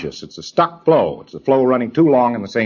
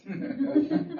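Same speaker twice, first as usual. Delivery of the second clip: high laugh; 10 dB quieter than usual. Real, not acted. Laughs at the slow auditor.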